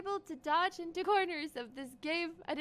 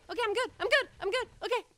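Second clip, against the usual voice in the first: usual speaking voice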